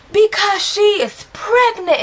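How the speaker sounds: high, slow, drawn-out announcement; hammed-up high-pitched outburst; dramatic blurted reveal. EJ blurts the secret, retold.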